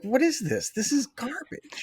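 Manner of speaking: high, soft disbelief; mock dismay. Disappointed fan retells reaction to the speech, mock dismay.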